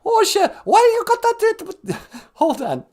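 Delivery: loud falsetto caller losing the thread; very high royal caricature; Queen Elizabeth. Acted. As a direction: protest shrilly, then falter. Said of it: "Hey, Queen Elizabeth".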